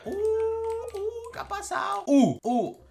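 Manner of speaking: very high-pitched taunting hoot; burla aguda. Acted. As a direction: gloat in a squeaky put-on voice. Se burla tras presumir de mascota. (burla con vocecita muy aguda)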